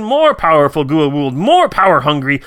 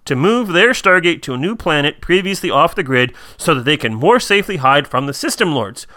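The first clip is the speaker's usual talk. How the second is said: ordinary talk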